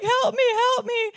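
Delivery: put-on high, loud playful plea of a tackled child; mock cry for help. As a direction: squeal for help like a kid pinned in play. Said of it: "he's like".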